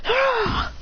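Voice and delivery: very high ah; high anime yell; high kick yell. Acted. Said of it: "He goes".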